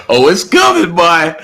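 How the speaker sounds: ordinary delivery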